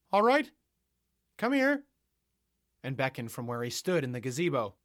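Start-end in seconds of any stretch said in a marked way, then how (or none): 0.1-1.9 high, loud call to come over; loud summons. Acted. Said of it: "then replied".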